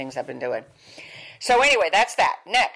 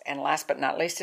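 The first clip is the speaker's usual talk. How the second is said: usual speaking voice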